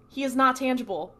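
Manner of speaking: ordinary talk, nothing marked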